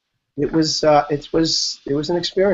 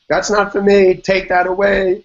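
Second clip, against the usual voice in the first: higher, insistent refusal, half asleep; mock protest; 8 semitones higher, 3 dB louder than usual. Patient waking at night refusing the gurney, mildly indignant. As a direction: push the gurney away, a touch indignant.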